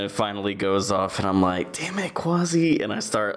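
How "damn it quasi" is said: exasperated mock scolding. Acted scolding of messy friend.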